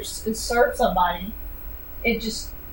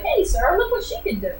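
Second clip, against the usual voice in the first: mock eager pitch; 8 semitones higher than usual. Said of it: "they're like".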